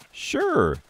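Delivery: very high, squeaky clown reply; eager mock agreement. Clown accepts the message errand, squeaky mock eagerness. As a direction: chirp it up high in naive agreement.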